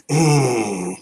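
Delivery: low, quiet strained grunt; drawn-out soft grunt; Blade grunt. Shown as a demonstration. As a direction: impersonate a tough guy's groaning noise. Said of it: "Here's my Blade impersonation".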